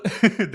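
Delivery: short bright laugh after the clip; a quick real laugh. Real, not acted. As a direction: laugh it off lightly. Host laughs after sneak peek.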